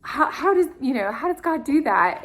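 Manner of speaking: amazed. Retold or imitated. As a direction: voice her wonder at the coincidence. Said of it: "she just said".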